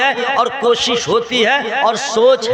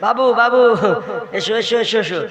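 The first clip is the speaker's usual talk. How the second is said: coaxing grandson; 4 semitones lower than usual. Pretend. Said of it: "आप तड़प जाते हैं".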